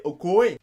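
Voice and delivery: ok dit « okoé ». Shown as a demonstration. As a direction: glisser de O vers É dans Okoé. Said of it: "Tu peux le prononcer Okoé". (« okoé » à la québécoise)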